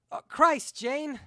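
high-pitched, appalled outburst; startled cry. A narrator's reading. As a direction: cry out in shocked disgust. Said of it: "the first cried".

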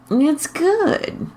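high, cocky bragging; eager, boastful lift. Acted. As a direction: show off to the secretary. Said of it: "Howard said".

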